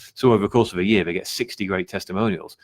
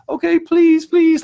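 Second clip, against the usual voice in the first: high, loud mock pleading; pathetic begging; 8 dB louder, slower than usual. Coach mimicking a desperate seller at the prospect's feet, hammed-up begging. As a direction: grovel like a desperate beggar, hammed up. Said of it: "like, begging at their feet".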